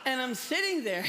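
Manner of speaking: chuckling through the words; amused laughter while telling the story; laughing. Real, not acted. Laugh heard recalling awkward scene.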